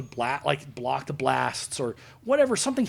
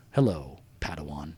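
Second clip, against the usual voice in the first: grave reveal; 13 semitones lower, 5 dB quieter than usual. Retold or imitated. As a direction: drop the voice deep for the big reveal. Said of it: "and he says".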